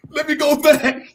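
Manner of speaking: cracking up at his own joke; laughing. Real, not acted.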